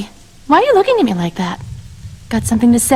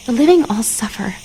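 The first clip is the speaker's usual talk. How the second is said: usual speaking voice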